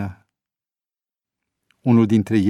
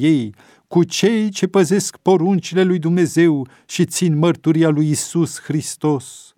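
higher, louder declaiming of scripture; raised-voice recitation; 5 semitones higher, 4 dB louder than usual. A narrator's reading. Prisoner reciting the Apocalypse aloud to the others, declaiming. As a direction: proclaim the verses to the whole cell. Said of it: "își înălță glasul și începu să recite".

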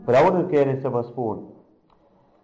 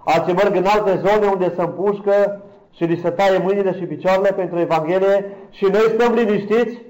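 raised, louder, challenging sermon delivery; impassioned preaching; 7 semitones higher, 6 dB louder than usual. Real, not acted. Preacher challenging his passive congregation, impassioned. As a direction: preach it high and hard, building to the question.

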